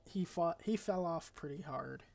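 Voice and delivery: usual speaking voice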